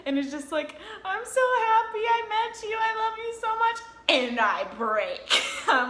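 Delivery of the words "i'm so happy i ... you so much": high-pitched, singsong mock gush; imitated emotional gushing. Imitating a friend mid tackle hug, high happy gushing.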